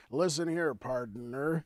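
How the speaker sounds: drawn-out partner, holding the N; cowboy leaning on partner; cowboy drawl. Pretend. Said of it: "say partner and hold the N".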